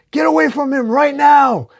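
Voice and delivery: very high, loud shout; full-out high-pitched command; furious yell. A narrator's reading. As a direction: yell them away from a loved one. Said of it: "as Dylan yells".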